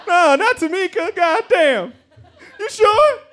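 very high, loud mock sobbing; fake crying. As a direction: wail in badly faked disbelief and grief. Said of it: "had to fake cry".

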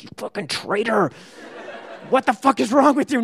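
high, strained mock outrage; retold indignation. Teenager glaring at the dad who called cops, indignant. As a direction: berate a traitor under strained breath.

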